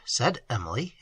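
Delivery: usual speaking voice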